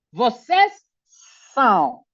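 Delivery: "são" drawn out as a nasal ow. Shown as a demonstration. Teacher demonstrating to students, nasal "são". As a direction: slowly say "vocês são", air through the nose on "são".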